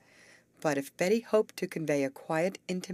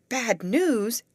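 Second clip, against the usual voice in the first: alarmed echo; 9 semitones higher, 7 dB louder than usual. A narrator's reading. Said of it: "echoed Molly".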